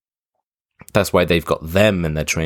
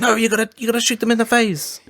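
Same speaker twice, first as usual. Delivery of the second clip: frantic commander. Retold or imitated. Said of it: "And he's like".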